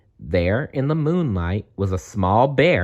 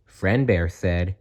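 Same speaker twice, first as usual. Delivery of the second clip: plain reading voice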